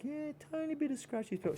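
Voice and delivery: tiny, very high squeak; high and soft, playful put-on voice; minimizing. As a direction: make the complaint sound teeny in a high little voice. Acted patient with a trivial complaint.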